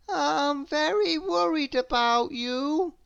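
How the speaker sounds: high, fretting little voice; anxious high character voice; fretful Mister Worry. Acted.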